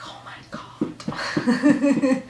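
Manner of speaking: slow, breathless whispered awe; awed whisper. Retold or imitated. Fan back in her seat, whispering in awe. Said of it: "but I was like".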